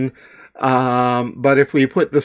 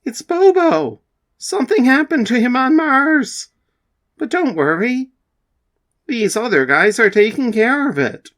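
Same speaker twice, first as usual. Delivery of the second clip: high female character; 10 semitones higher than usual. Acted.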